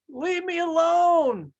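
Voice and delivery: high, loud, slow cry to be left alone; the teacup yelling at its master to leave it alone; yelled protest. Acted. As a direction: shout it out, pleading to be let go. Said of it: "I was yelling".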